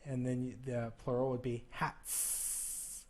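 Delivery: "hats" slow, ending on a hissed s; slow careful "hats"; slow exaggerated hats. Shown as a demonstration. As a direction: stretch "hats" to show the s. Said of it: "I'm gonna emphasize the sound".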